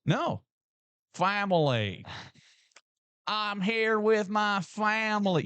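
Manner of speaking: mock southern accent. S0 mocks Brian Kelly's new southern accent, slow and high. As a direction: ham up a southern coach accent. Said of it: "You've never talked like that in your life".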